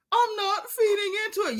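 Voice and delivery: high, whiny imitation of tearful denial; badly faked sobbing through a laugh; mock crying. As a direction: mimic someone crying and protesting. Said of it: "she was crying while she was saying".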